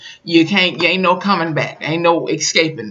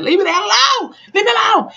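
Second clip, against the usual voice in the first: high-pitched imitated yell; Violet. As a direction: yell it high, sick of him.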